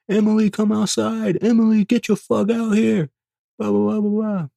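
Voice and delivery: high mocking shout of a name; mock angry girlfriend. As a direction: imitate the ex screaming outside. Angry ex banging on door.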